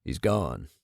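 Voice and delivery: low, soft murmur; hushed, dropped right down in pitch; barely audible. A narrator's reading. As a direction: say it too softly to be heard clearly. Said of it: "too softly to hear clearly".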